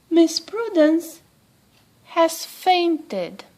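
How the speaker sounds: a lightly voiced butler, slow and formal; butler's announcement. Butler tells the princess Prudence fainted, slow and formal.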